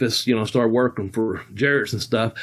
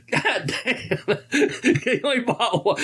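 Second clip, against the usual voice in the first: mock disbelief, higher, breaking into laughter; laughing; 9 semitones higher, slower than usual. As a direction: squeeze it out between laughs, half outraged. Mock-exasperated Bobby through laughter.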